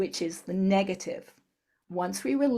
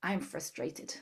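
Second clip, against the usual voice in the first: low, much softer statement; soft tapping phrase; 4 semitones lower, 9 dB quieter, slower than usual. Shown as a demonstration. A therapist quietly voicing the phrase at the chin. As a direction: drop the voice as you tap.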